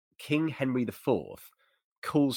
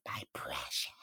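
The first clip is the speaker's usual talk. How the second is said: low, hushed, breathy creature whisper clutching the crown; Gollum; 8 semitones lower, 10 dB quieter than usual. Retold or imitated. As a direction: whisper it like a creature hoarding treasure. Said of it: "I call it the Gollum from the Lord of the Rings moment".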